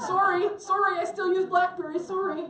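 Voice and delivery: high, loud, laughing bad Canadian accent; apologetic Canadian accent, laughing. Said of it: "that was my bad Canadian accent".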